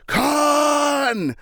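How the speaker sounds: high, stretched mock yell of a name; imitated yell. Kirk yelling into the communicator at Khan, stretched yell. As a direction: belt the name out long and high. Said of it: "Kirk yelling into the wrist communicator".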